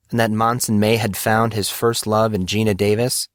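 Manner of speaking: plain reading voice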